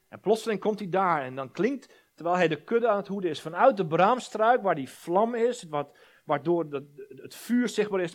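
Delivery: impassioned preacher telling of Abraham; preaching at full force. Real, not acted. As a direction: build the story with rising fervour.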